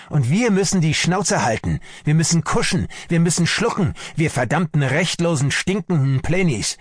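high-pitched, hammered-out outburst; bitter rage. A narrator's reading. Army doctor rails at prisoners having to submit, bitter rage. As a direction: rage through each repeated phrase.

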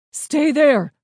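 high, loud command; yell. A narrator's reading. Dad calling out to hidden children, commanding yell. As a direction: call it out forcefully from afar. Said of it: "Dad yelled out to us".

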